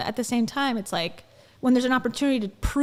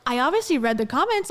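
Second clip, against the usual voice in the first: ordinary delivery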